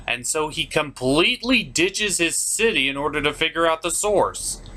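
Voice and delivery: ordinary delivery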